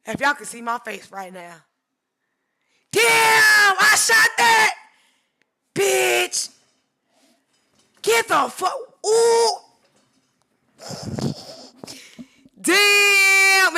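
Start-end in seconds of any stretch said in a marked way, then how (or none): 2.9-13.8 ecstatic shrieks, much higher and louder; overjoyed screams at her own photo; real screaming. Real, not acted. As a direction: scream with pure delight. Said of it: "I'm yelling and screaming".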